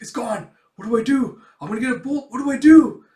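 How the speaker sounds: high, loud, escalating panic; mock freak-out. Boy finds his handkerchief gone, mock panic. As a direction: build to a loud hammed-up wail. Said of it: "freaks out".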